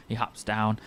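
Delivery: ordinary delivery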